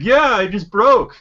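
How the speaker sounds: high, drawn-out hammed-up reply; exaggerated B-movie line reading; mock cheesy hero. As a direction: stretch it out high and loud, spoofing bad acting. Mocking hero's line in cheesy scene.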